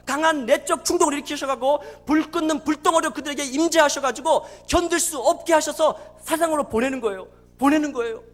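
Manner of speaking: urgent, emphatic pulpit exhortation; full-force preaching. Real, not acted. Preacher urging the church to stand stripped before God, full-force preaching. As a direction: press each demand home forcefully.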